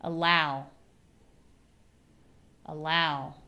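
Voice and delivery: "Allow" with a weak a and stressed low; stress on second syllable. Shown as a demonstration. Teacher giving a word to repeat. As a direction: say it for them to repeat. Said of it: "repeat it like me".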